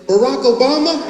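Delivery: high, loud eager question rising at the end; excited guess. Retold or imitated. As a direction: blurt it out as a thrilled, hopeful question. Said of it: "she excitedly said".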